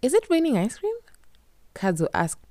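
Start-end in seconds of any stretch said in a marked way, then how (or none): 0.0-1.0 high, louder delighted question; pleasantly surprised. A narrator's reading. Kadzo tasting snow, pleasantly surprised high question. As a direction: gasp it out in happy wonder. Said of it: "pleasantly surprised".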